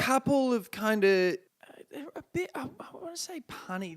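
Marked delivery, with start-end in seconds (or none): 1.9-4.0 whispered, much softer. Real, not acted. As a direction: breathe it out. Hesitant aside about puns.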